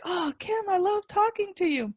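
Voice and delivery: high, soft gushing praise; gushing client. Retold or imitated. Host imitates clients gushing over her, high and soft. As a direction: coo it up high like a smitten fan. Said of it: "that's why they're like".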